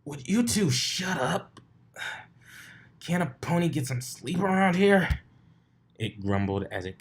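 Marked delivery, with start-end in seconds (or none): 0.0-5.2 a woken creature snapping at noisy ponies; grumpy raspy voice. Acted. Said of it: "annoying, raspy voice".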